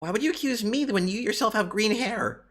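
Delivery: put-on squeaky hurried kappa girl; high girl voice. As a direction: brush them off in a high hurried voice. Same character, protesting.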